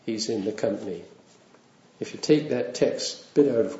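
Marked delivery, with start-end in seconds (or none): none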